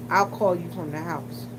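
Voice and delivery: ordinary delivery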